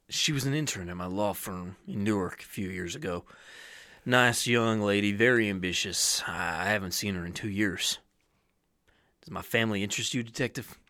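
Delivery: a hint of an older lawyer; low-key, lightly acted suspect; lightly voiced suspect. John Casey answering the detective, lightly guarded.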